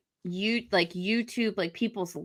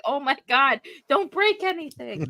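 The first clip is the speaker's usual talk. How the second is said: anxious plea; 7 semitones higher, 3 dB quieter than usual. Retold or imitated. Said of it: "I was just like".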